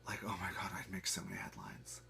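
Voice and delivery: hushed conspiratorial whisper; breathy aside to the crowd. Pretend. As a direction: whisper it like a juicy secret. Imagined headline-making aside.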